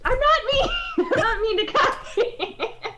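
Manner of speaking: laughing denial. Real, not acted. Laughing denial of being mean.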